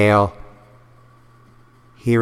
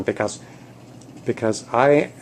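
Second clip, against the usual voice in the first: higher-pitched, halting exasperation; exasperated trainer; 7 semitones higher than usual. Acted. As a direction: pitch climbing into indignant complaint. Trainer realises pupils are ignoring her.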